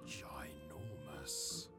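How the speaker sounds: ordinary delivery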